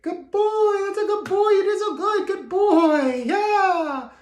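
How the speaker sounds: very high, loud, silly dog praise; silly excited praise. Shown as a demonstration. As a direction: go way up high and gush over the dog. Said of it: "it needs to be a little on the silly side".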